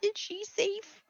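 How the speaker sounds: high, slow chipmunk Vader; squeaky cartoon chipmunk voice. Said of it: "using the chipmunk voice".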